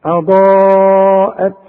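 اضاءت with long loud heavy ضا, then thin ءت; light second hamza. Shown as a demonstration. Teacher shows the contrast, long heavy ضا then light ءت. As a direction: hold ضا and release a light hamza. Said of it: "والهمزة التانية مرققة".

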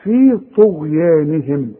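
في طغيانهم with heavy ط and light ي; careful tajweed reading; slow recitation. Shown as a demonstration. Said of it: "الطاء مفخمة".

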